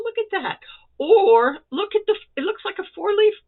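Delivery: high, loud bursts of delight; delighted squeal. Real, not acted. Crafter showing off a friend's squares, squealing with delight. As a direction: squeal over each square.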